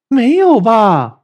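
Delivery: drawn out, loud and high, teasing; loud rhetorical question. Real, not acted. Teacher challenging the class with a slow loud question. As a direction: stretch it slowly like an obvious no.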